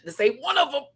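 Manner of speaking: high mock-outraged repeat; high squealed verdict.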